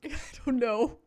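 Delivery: laughing. Real, not acted. Laughs at the rap.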